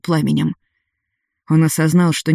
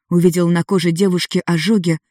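usual speaking voice

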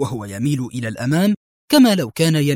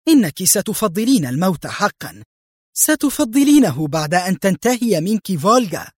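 higher-pitched, menacing promise; fierce threat; 6 semitones higher than usual. A narrator's reading. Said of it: "ببريق شرس مخيف وهي تردف".

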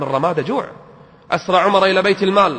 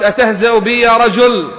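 louder, higher indignant retort; indignant outcry; 5 semitones higher, 7 dB louder than usual. Retold or imitated. Old woman rebuffing Omar, indignant outcry. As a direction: throw it back at him, offended. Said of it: "قالت".

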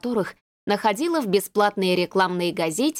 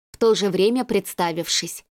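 usual speaking voice

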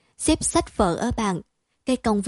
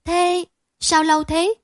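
higher, louder astonished question; wide-eyed disbelief; 7 semitones higher, 4 dB louder than usual. A narrator's reading. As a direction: gasp it out in disbelief. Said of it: "Chị cả mở to mắt".

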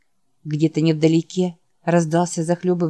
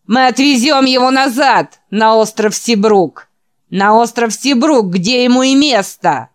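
jeering shout; 5 semitones higher, 7 dB louder than usual. Acted. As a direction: shout the demand tauntingly from outside. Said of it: "прокричал снаружи другой насмешливый голос". (насмешливый крик)

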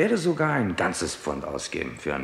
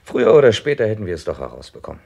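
louder, pointed reply; firm; 5 semitones higher, 12 dB louder, faster than usual. Acted. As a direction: press the point home firmly. Inspektor triumphiert über die Zeugin. (bestimmt und lauter)

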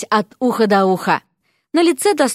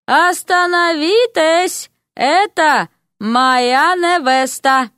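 loud, slow and commanding from the doorway; outraged shout; 6 semitones higher, 3 dB louder, much slower than usual. Acted. Prince stopping the wedding from the door, booming shout. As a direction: halt the ceremony with a booming cry.

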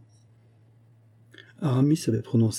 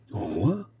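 softer, mock-offended question with rising end; feigned displeasure; 5 dB quieter than usual. A narrator's reading. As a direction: echo it as if displeased, putting it on. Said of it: "en affectant le mécontentement". (mécontentement feint)

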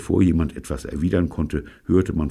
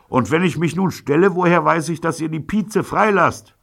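calling across; 5 dB louder than usual. A narrator's reading. Robert calls across street.

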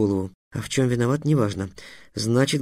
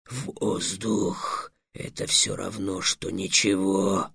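soft, low dragon protest; a low half-hearted objection; arguing dragon; 4 semitones lower, 6 dB quieter, much slower than usual. A narrator's reading. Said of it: "попробовал было спорить дракон". (низкое вялое возражение дракона)

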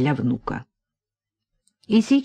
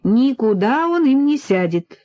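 higher, breezy brush-off; dismissive; 7 semitones higher, 4 dB louder than usual. A narrator's reading. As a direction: wave the worry away. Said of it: "снова отмахнулась Полина". (отмахиваясь)

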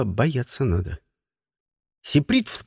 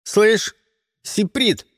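taunting; 5 semitones higher, much slower than usual. Acted. Bully taunts the new boy.

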